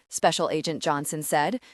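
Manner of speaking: plain reading voice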